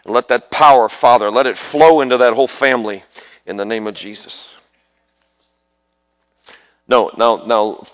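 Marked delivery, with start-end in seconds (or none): 0.0-4.5 loud, driving prayer over the family, full preaching force; fervent prayer. Real, not acted. Preacher praying over a mother's sons, loud fervent prayer. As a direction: pray it out loud like a revival preacher.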